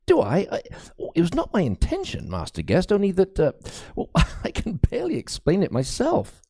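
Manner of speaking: high, flustered priest with a nervous laugh; high, halting priest, embarrassed and afraid; fearful young priest. A narrator's reading. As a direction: laugh off his confusion nervously.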